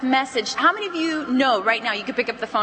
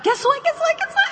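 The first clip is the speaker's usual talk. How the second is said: very high, climbing squeal; bursting with gossip. Shown as a demonstration. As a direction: repeat it frantically, itching to tell. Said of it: "sound like you've got gossip".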